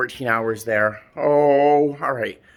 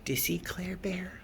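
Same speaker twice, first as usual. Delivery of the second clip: whisper; 6 semitones higher, 13 dB quieter than usual. Real, not acted. Whispering to pet about cat.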